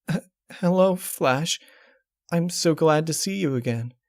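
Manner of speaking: high, trembling mare voice on the edge of tears; tearful Twilight. Acted. Twilight, crying, greets the disguised changeling. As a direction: greet him through welling tears.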